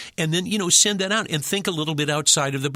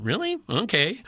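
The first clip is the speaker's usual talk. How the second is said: high questioning lift, then giving in; doubtful, then reluctantly agreeing; skeptical compliance; 8 semitones higher than usual. Acted. Actor privately doubting the client, skeptical compliance. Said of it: "even though you may be going".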